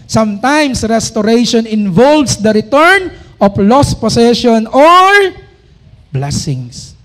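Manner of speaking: slow, loud, solemn verse reading; scripture reading. Acted. Preacher proclaiming a verse to the congregation, slow and loud. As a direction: proclaim each word of the verse slowly.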